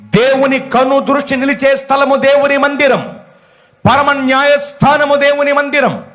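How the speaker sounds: high, declamatory sermon cadence; forceful preaching. Real, not acted. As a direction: proclaim each line slowly and high. (ఉద్వేగంగా, హెచ్చు స్వరంతో ప్రబోధం)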